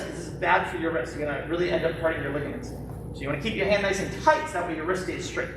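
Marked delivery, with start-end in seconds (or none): none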